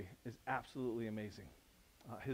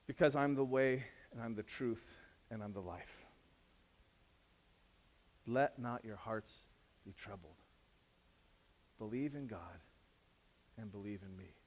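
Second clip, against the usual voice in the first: gentle Jesus; 4 semitones lower, 5 dB quieter than usual. Retold or imitated. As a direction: say it gently, hushed. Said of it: "Jesus answers".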